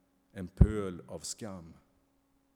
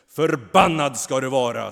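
louder, higher shouted curse; roaring curse; 5 semitones higher, 15 dB louder than usual. A narrator's reading. The angel curses Kain, shouting in fury. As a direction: hurl the curse down on him. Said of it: "skriker han".

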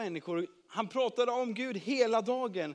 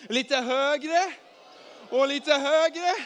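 high, loud calls building to a yell; rising shouts; 8 semitones higher, 10 dB louder, much slower than usual. Shown as a demonstration. Storyteller leading children as the arm rises, building shouts. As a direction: get louder and higher with each call. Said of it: "kan ni ropa lite högre och högre".

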